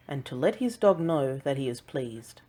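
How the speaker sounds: ordinary delivery, nothing marked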